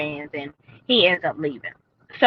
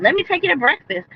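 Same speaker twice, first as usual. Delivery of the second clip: imitated joyful excitement; 9 semitones higher than usual. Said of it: "she was so excited and overwhelmed with joy".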